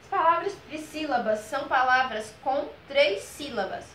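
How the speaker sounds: normal talking voice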